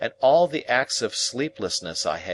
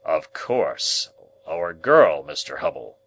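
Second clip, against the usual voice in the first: irritable; 3 semitones lower, 5 dB louder, slower than usual. Acted. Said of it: "rather irritably".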